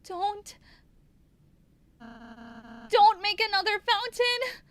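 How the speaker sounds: high, loud yell; desperate shout. Acted. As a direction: scream to stop them. Shouts at fleeing enemy.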